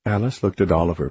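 ordinary delivery

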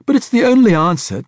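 very high, loud stammering passion; impassioned outburst. A narrator's reading. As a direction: pour it out breathlessly.

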